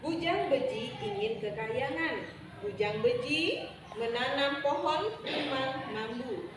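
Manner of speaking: usual speaking voice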